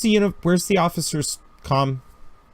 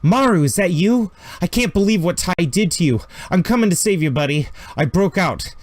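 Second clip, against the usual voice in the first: Jessica over the comm; 5 semitones higher than usual. Acted. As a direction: rush it out over the radio, desperate. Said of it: "You hear".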